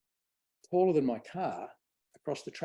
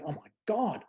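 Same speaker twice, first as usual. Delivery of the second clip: retold shock; 6 semitones higher, 3 dB louder than usual. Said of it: "shocked and going".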